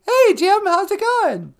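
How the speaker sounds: very high, loud puppet-like greeting; high-pitched comic voice; squeaky sidekick. Pretend. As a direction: squeal a hello.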